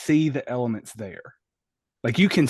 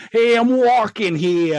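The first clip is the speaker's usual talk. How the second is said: loud, slow, hammed-up Brooklyn accent; 8 semitones higher, 7 dB louder, slower than usual. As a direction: do an over-the-top Brooklyn tough guy. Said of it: "He didn't have to go with a Brooklyn accent".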